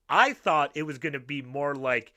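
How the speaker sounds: ordinary delivery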